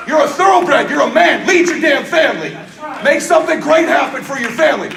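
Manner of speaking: loud, high, driving sermon delivery; pumped-up motivational sermon, almost shouting; fiery preaching. Acted. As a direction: preach it to the crowd at full force. Preaching at full force.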